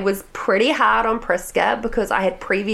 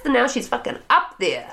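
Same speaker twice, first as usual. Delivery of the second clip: usual speaking voice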